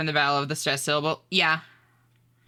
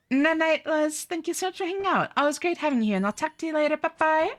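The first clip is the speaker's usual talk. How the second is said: bright high-pitched streamer greeting, much higher than usual; 11 semitones higher, faster than usual. Acted. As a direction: chirp a cheerful hello to chat in a high voice. High goodbye to viewer.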